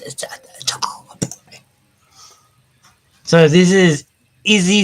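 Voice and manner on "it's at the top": whispered mutter; whispering. Real, not acted. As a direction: breathe it under your breath. Muttering while searching screen.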